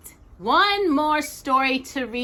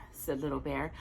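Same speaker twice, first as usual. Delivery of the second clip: ordinary delivery, nothing marked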